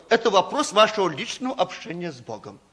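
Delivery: ordinary talk, nothing marked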